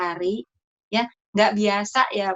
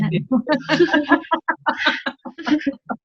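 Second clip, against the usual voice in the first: long, higher-pitched burst of laughter; hearty laugh; 7 semitones higher than usual. Real, not acted. Speaker sharing a joke with the women, laughing heartily. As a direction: let a real laugh run on. Audience agrees about venting, laughter follows.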